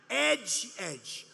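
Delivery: "Edge" said crisply like the edge of a building; pronunciation demo. Shown as a demonstration. Speaker drilling the crowd on the name, plain demo. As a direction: land each "edge" separately. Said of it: "Say edge like the edge".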